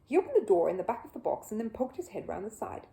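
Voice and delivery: plain reading voice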